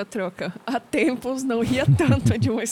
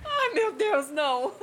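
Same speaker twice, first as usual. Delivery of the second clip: higher, laughing through the words; 8 semitones higher, 4 dB quieter, slower than usual. Real, not acted. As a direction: crack up at the story. Reacts to the funny story. (rindo muito)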